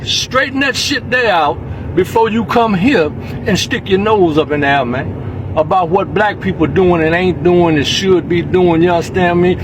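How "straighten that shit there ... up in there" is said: ordinary talk, nothing marked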